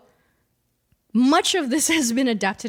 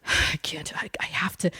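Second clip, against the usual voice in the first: hushed, halting whisper; mock agonizing; 7 semitones lower, 5 dB quieter than usual. Husband torn between leaving and his family, agonized whisper. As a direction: whisper it like a man wrestling with himself.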